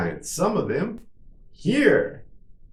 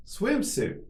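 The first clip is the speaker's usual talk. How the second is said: much higher, shorter take; quicker model; 11 semitones higher, 3 dB quieter than usual. Shown as a demonstration. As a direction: say it quickly on a raised pitch. Teacher models word for repetition.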